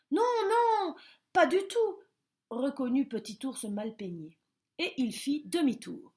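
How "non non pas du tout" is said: high, loud hasty denial. A narrator's reading. Bear hastily agreeing with the squirrel, high and loud. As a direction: quickly agree.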